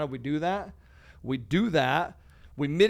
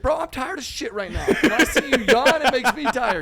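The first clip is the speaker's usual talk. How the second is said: 2 much higher and louder, hammed-up gym bro; 3 play the big gym guy complaining, exaggerated and loud; 1 imitating big gym dude; 10 semitones higher, 11 dB louder than usual. 4 big gym dude complaining to host about his yawning, hammed-up loud. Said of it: "He's like".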